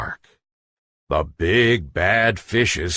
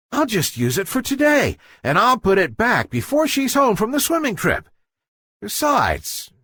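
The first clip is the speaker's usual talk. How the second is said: put-on eager boy fish voice; 11 semitones higher, faster than usual. Guppy Goop scheming aloud.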